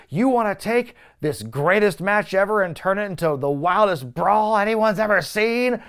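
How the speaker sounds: the host imitates Cena hyping the match to the crowd like a carnival barker. Imitating Cena selling the match like a carnival barker. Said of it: "Cena is full-on carnival barker".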